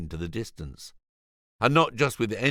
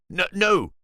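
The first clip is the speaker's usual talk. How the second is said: flustered; 10 semitones higher, 5 dB louder than usual. Acted. George correcting caller.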